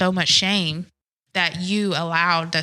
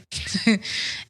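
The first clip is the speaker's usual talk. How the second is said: a quick, unvoiced burst of laughter; short laugh; 2 semitones higher, 4 dB quieter than usual. Real, not acted. Recalling dad running up the steps, short real laugh.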